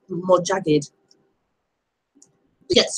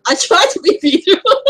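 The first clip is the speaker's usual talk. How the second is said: playful; 9 semitones higher, 6 dB louder than usual. Real, not acted. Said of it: "Oh, thank you".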